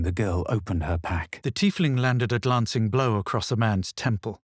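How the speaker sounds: ordinary delivery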